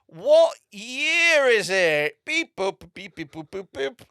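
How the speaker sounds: high, very slow, loud robot question; robot character. Retold or imitated. Robot act quizzing the crowd, drawn-out mock-robot voice. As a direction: stretch each vowel like a mock robot, then beep. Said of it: "Comes on stage as a robot".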